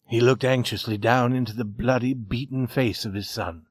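ordinary delivery, nothing marked

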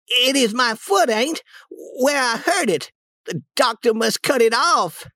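put-on high, wailing boy's voice; milk the injury in a high plaintive voice; pained act. Tom faking a bad foot to his aunt, high pained wail.

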